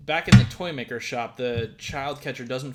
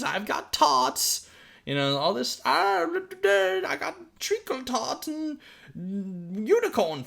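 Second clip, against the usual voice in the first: much higher, slow sing-song sweet seller; Candyman; 9 semitones higher, slower than usual. Retold or imitated. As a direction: lure the children with a sugary high pitch. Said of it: "comes back as the Candyman".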